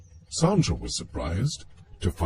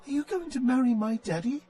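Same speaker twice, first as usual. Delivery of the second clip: put-on a much higher, fast little-girl voice, innocently blunt; much faster than usual. Ellie asks Sandra if she will marry her daddy, high and childlike. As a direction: ask straight out like a curious six-year-old. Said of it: "she asked unnervingly".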